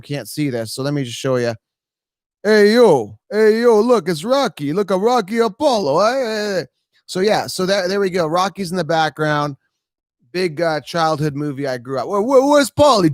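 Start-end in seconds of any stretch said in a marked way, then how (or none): 2.4-6.6 boxer hollering to his pal; Rocky. Acted. Streamer showing viewers his movie picture, Rocky impression. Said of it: "make fun of my Rocky impression". 12.1-13.1 loud, high, stammering boxer's shout; Rocky. Acted. Streamer answering a viewer's question, Rocky impression. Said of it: "my Rocky impression".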